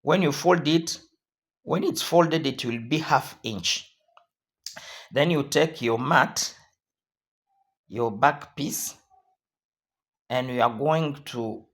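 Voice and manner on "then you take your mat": ordinary talk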